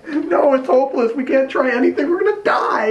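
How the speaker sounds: high wail of mock hopelessness; crying Jed. Jed refuses his partner's comfort, hammed-up crying. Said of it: "Quit crying".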